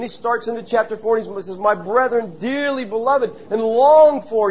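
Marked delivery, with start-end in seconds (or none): 1.6-4.5 fond letter reading. Acted. Reading Paul's letter fondly.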